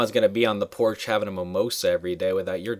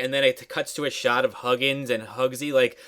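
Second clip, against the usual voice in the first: ordinary delivery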